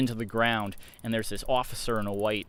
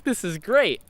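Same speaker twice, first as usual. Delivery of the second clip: gleeful; 10 semitones higher, 5 dB louder than usual. Retold or imitated. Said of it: "I'm just like".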